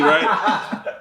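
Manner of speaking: normal talking voice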